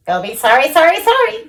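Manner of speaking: high goose calling out; sing-song goose warning. A narrator's reading. Goose calling after Wilbur, high. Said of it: "called the goose".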